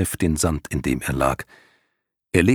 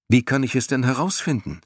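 put-on eager boy voice; 6 semitones higher than usual. Bastian asks eagerly.

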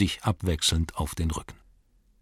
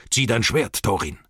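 urgent, higher-pitched call; called command; 3 semitones higher than usual. A narrator's reading. Wizard ordering a dwarf to fight, calling out urgently. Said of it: "rief Gandalf".

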